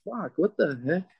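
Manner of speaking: ordinary delivery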